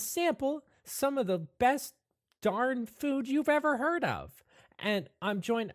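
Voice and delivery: high-pitched, swooping presenter voice; host the show with big, high energy; perky show host Savannah. Acted. [name] introduces Burger Boys Interdimensional on camera, perky host.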